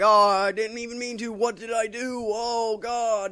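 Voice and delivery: hammed-up tormented Superman. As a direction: plead like a guilt-stricken hero. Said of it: "all fucking tormented and shit".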